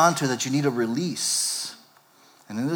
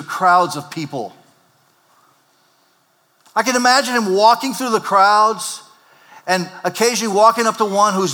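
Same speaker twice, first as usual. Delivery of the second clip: raised, driving sermon voice; preaching at full force; 6 semitones higher, 6 dB louder than usual. Acted. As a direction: preach it out to the whole room. Preaching the story to congregation.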